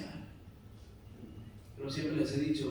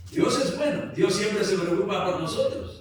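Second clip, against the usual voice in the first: loud, high-pitched declaration to the congregation; full-force preaching; 7 semitones higher, 11 dB louder than usual. Real, not acted. Pastor assuring the congregation of God's care, full-force preaching. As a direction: proclaim it loudly from the pulpit.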